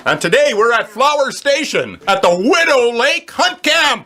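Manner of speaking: upbeat broadcast welcome; radio host intro. Acted. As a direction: welcome listeners like a live show. Radio host opening the show.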